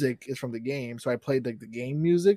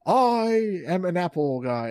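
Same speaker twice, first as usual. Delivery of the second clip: slow, drawn-out mock grandeur, higher pitched; mock trailer hero; 5 semitones higher, much slower than usual. Host mocking the Steve Jobs trailer, slow mock grandeur. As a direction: inflate it like an overblown movie trailer. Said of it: "the trailer was like".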